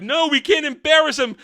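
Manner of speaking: high, loud imitation of a worried husband; mock protest. Acts out the couple's quarrel.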